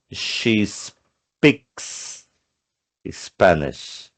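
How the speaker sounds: She spoken with a rise, speaks with clear S; teacher highlighting the S; stress on She speaks. Shown as a demonstration. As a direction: lean on the S in speaks. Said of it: "não posso esquecer de acrescentar o S".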